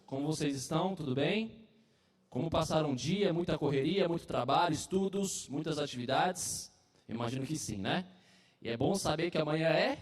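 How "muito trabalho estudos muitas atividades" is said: normal talking voice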